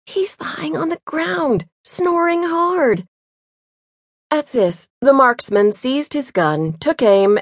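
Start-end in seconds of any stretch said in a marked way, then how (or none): 0.0-3.1 higher, slow and hushed; drawn-out amazed scorn at the sleeper; scornful listener. A narrator's reading. Sharp-eared comrade mocks the sleeping runner, high and slow. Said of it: "he suddenly exclaimed".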